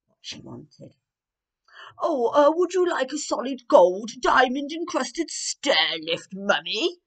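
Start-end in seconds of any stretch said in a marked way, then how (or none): 2.0-7.0 higher, louder eager son; bright pleading prince offering gifts; prince's voice. Acted. Prince guessing a gift for his mother: higher, louder eager voice. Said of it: "guessed the prince".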